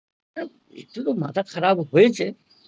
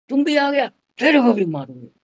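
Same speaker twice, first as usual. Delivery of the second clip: threat; 6 semitones higher, 5 dB louder than usual. Retold or imitated. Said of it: "ऐसा".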